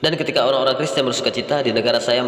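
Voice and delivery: normal talking voice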